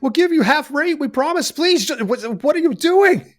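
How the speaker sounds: high, pleading captive voice; pleading elf parents. Acted. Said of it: "They are currently scre".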